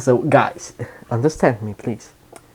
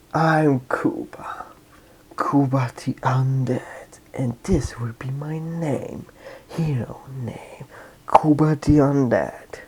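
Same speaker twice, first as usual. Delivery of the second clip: slow, hushed dramatic naming; whispered reveal; 9 dB quieter than usual. Retold or imitated. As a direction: whisper your hero name like a legend.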